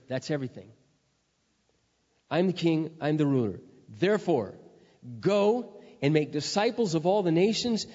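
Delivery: loud, driving pulpit voice; fiery preaching. Acted. Pastor reading Matthew twenty-eight to the church, fiery preaching. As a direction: proclaim the verse like a herald.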